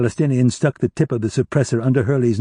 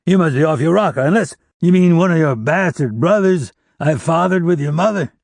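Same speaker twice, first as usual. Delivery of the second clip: insulting jeer; 7 semitones higher, 4 dB louder than usual. Acted. Hurley insults Roddy.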